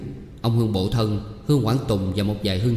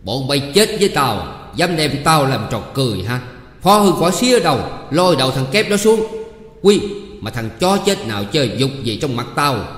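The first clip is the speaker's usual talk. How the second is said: building rage to much higher, louder shouts; furious yelling; 3 semitones higher, 4 dB louder than usual. Acted. Humiliated official screams at the troupe and crowd, furious. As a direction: rage at the crowd that mocks him. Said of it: "càng thêm nổi nóng".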